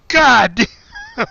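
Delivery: high, loud drawn-out God damn; mock dismay. Hears about the troll, loud mock dismay. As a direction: groan it in mock disbelief. Amused disbelief at brother.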